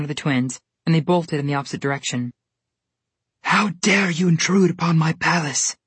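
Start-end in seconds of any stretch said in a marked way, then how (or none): 3.4-5.8 mild scream. A narrator's reading. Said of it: "the Snow Queen screamed".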